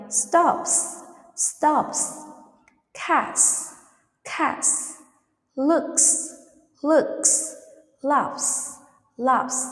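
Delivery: words like "stops", "looks" ending in unvoiced S. Shown as a demonstration. Teacher gives examples to repeat, S ending on "stops". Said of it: "the pronounced like sound".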